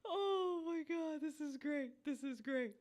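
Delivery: slow, soft, crying with laughter; helpless laugh, nearly crying; laughing to tears. Real, not acted. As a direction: gasp it out through tears of laughter. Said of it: "laughing crying reaction".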